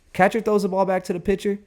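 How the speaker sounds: excited retelling. Retold or imitated.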